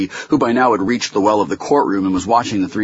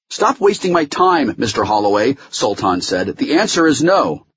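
high, emphatic refusal; irate refusal; 7 semitones higher, 3 dB louder than usual. Acted. Judge refuses Holloway's witness, in an irate voice. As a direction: shut him down firmly from the bench.

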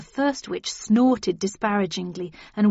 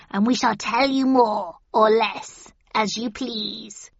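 witch voice. A narrator's reading. Witch bargaining.

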